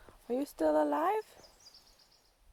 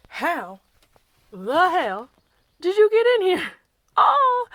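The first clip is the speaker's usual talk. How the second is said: high, loud disbelief; loud bewilderment; 6 semitones higher, 12 dB louder than usual. Real, not acted. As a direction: exclaim it, baffled at the intruder. Startled by animal that got in.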